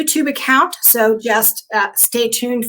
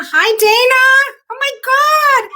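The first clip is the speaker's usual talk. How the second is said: much higher, louder, slow delighted cry; overjoyed greeting; 12 semitones higher, 5 dB louder, slower than usual. Real, not acted. Host sees longtime friend Dana join, thrilled slow cry. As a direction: stretch it out, thrilled to see her.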